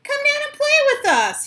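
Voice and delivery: high loud call up to brother. Acted. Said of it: "he called".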